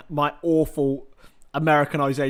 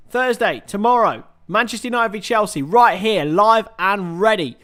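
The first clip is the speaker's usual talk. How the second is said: hype announcer; 4 semitones higher, 6 dB louder than usual. Acted. Announcing next stream.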